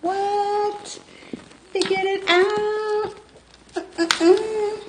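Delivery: very high, drawn-out playful squeal; playful high-pitched little victory; high sing-song gloating. Pretend. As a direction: crow in a high, held-out voice. Celebrates solving the camera problem.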